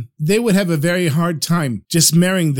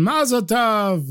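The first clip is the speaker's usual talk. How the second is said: drawn-out, raised-pitch congratulation; mild mock cheer; 4 semitones higher, slower than usual. A narrator's reading. Drunken guests congratulating the mock bride and groom, mild cheer. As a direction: call it out slowly, without much volume. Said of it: "And everyone shouts".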